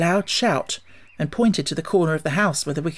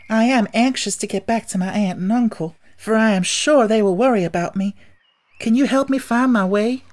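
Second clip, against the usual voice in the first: put-on higher, girlish and pleading; a lost little girl asking for help home; young Dorothy; 6 semitones higher, 4 dB louder than usual. A narrator's reading. Dorothy asking the Munchkins for help, higher girlish voice.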